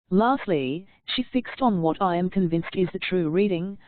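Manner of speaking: usual speaking voice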